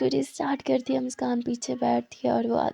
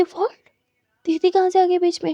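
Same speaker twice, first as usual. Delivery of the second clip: higher, louder baffled protest; interrupted lover asking loudly and higher what happened; startled confusion; 5 semitones higher, 6 dB louder than usual. Acted. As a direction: thrown off mid-moment, indignant.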